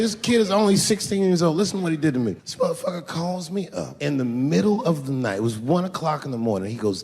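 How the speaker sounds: normal talking voice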